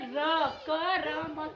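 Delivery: "र, क, र, म" अलग-अलग अक्षर, ज़ोर से; sounding out letters. Shown as a demonstration. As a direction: spell out "र, क, र, म" sound by sound. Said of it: "तो इसको हम बोलते हैं". ("र, क, र, म" एक-एक अक्षर तोड़कर)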